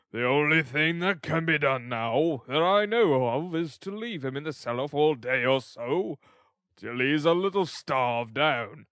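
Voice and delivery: loud, measured official oration; orating parish officer; pompous beadle, pronouncing. Acted. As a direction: hand down a harsh remedy with self-important weight. Figure of Mister Bumble.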